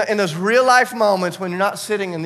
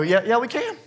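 naive eager agreement; 6 semitones higher, 3 dB louder than usual. Retold or imitated. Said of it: "just totally naive".

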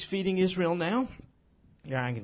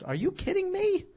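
incredulous; 3 dB louder than usual. Real, not acted. Incredulous preacher aside.

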